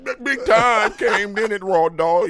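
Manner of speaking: loud, high, drawn-out words; hammed-up boast. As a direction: belt it out as a joke. Joking exaggerated boast.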